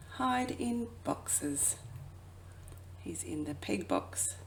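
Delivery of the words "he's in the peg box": ordinary delivery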